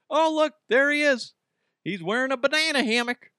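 high-pitched mock excitement at a sighting; mock excited sighting. Spotting the weird neighbor in a banana hammock, mock excited. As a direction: point him out, high and thrilled.

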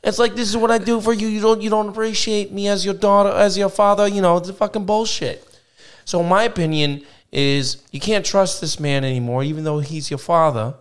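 Ben Soprano. Pretend. Host ranting about caller's father, in a mobster accent. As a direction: rant like a New Jersey wise guy. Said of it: "as Ben Soprano".

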